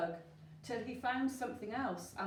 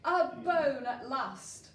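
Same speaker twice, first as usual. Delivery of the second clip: loud, slow, triumphant announcement; excited reveal; 4 semitones higher, 8 dB louder, slower than usual. A narrator's reading. Narrator revealing the bone to listening children, loud and slow. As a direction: proclaim the find like a prize.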